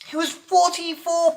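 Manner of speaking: loud, high jokey exclamation; a loud, high, joking punchline; mock exclamation. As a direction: shout out the gag. Joking correction.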